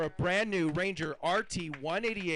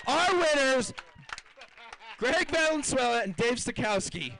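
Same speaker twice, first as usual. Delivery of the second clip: loud, higher announcer call of the winning weight; excited winner call; 8 semitones higher, 6 dB louder than usual. Real, not acted. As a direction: belt out the winners to the crowd.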